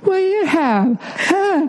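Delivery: high, whiny mock voice of Nia; childish mock whine; mocking impression of wife. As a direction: whine it like a spoiled kid, way up high. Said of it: "I object to this impression".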